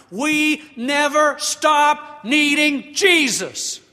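loud, high, slow sermon climax; preaching, building. Acted. Pastor telling the congregation they need Jesus, preaching at rising force. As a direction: build to a ringing declaration, stretching the key words.

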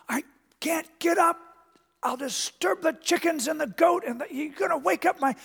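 high grandma-in-church stage whisper. Retold or imitated. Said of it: "a stage whisper".

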